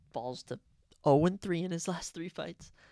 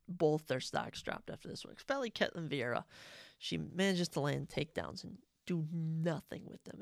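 ordinary delivery

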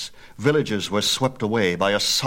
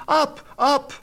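much higher, desperate shouted command; shouting; 4 dB louder than usual. A narrator's reading. Phaethon shouting the horses up from the burning village. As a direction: haul the horses up with your voice.

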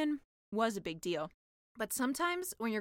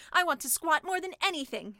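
eager mermaid; 5 semitones higher, 6 dB louder than usual. Acted. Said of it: "said the mermaid".

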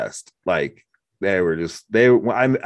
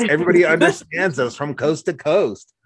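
mock proud retort; 7 semitones higher, 3 dB louder than usual. Proud retort about accent.

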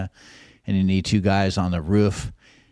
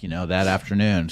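normal talking voice

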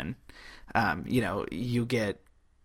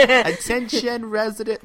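mock public announcement; 11 semitones higher, 8 dB louder than usual. Co-host spoofing the street sweepers to plug the website, mock loudspeaker announcement.

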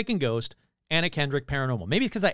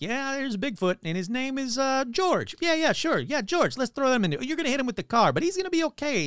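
high, lightly voiced silly AI; mocking the AI; 10 semitones higher than usual. Mocking the AI that named Bigfoot George, high silly voice. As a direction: parody the chatbot's eager logic. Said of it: "A I was like".

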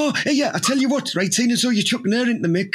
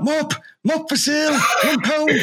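raised-pitch market trader's call; mock street-seller shout; 6 semitones higher than usual. Hawking mops on the high street, mock vendor's shout. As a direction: call out your wares, playfully. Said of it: "go into your local high street and shout".

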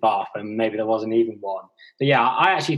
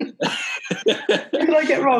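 high, slow, laughing protest; much slower than usual. Real, not acted. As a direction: laugh through the question. Laughing after teased about accent.

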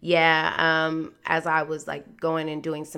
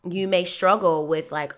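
ordinary talk

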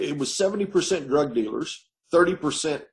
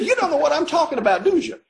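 higher, louder, fast mocking question; cellmate teasing; 12 semitones higher, 5 dB louder, faster than usual. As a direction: tease him quickly from up high. Cellmate mocking new inmate.

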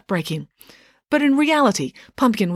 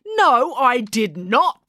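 slow, higher, emphatic denial; indignant protest; 6 semitones higher, 3 dB louder, much slower than usual. A narrator's reading. April denies mistaking the statue, indignant protest. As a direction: protest with each word weighted. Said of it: "protested April".

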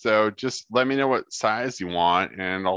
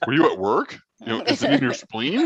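higher, fussing mock concern over the phone; mock worried friend; 5 semitones higher than usual. Playing a worried friend asking about the accident, mock concern. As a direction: ham up a worried pal checking in.

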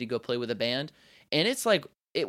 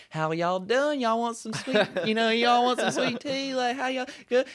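much higher, thick Southern mom voice; high, cheerful Southern mom accent; gung-ho Southern mom; 10 semitones higher than usual. Retold or imitated. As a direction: play a gushing Southern mom offering sweet tea. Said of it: "she's the gung-ho".

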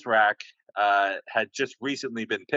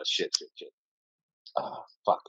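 muttered cursing; 3 semitones lower, 4 dB quieter than usual. Real, not acted. Losing his train of thought.

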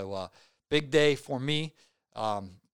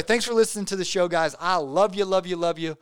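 excited, loud; 5 semitones higher, 7 dB louder than usual. Real, not acted. Excited sign-off to listeners.

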